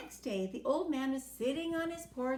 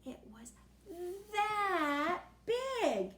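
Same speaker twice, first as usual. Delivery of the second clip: ordinary delivery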